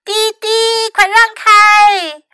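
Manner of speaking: high horn beeps into a loud warning; high, drawn-out call to clear the way. A narrator's reading. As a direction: honk high, then shout them aside. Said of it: "卡门叫道".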